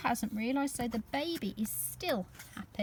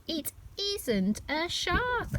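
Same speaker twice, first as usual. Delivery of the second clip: ordinary delivery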